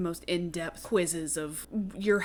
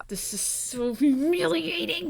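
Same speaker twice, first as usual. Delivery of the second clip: high, drawn-out whine; higher, slow self-pitying whine; embarrassed whine; 8 semitones higher, slower than usual. Retold or imitated. Embarrassed boy after bad haircut.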